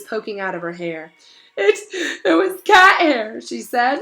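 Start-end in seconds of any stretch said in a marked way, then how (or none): 1.6-4.0 put-on high, halting, sobbing girl; tearful. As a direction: whimper it through tears. Hermione crying about cat hair.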